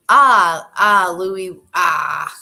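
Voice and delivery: put-on warming up a Newfoundland accent; hesitant Newfoundland accent starts. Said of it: "I'm gonna try this in a Newfie accent".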